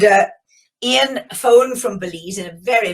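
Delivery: ordinary talk, nothing marked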